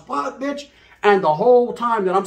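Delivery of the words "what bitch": indignant. Retold or imitated. Arguing with his lady.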